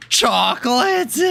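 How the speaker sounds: gasp, then high, slow amazed chocolate; a big cartoon gasp of wonder. Practising a SpongeBob character, gasping high and slow. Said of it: "I wish I could talk like some SpongeBob characters".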